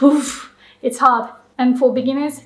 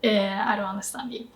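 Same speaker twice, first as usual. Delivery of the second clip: quick, soft, mock-confused reply; softly acted incomprehension; puzzled listener; 3 semitones lower, 7 dB quieter, faster than usual. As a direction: mumble it as a baffled listener, acted. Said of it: "Japanese people will be like".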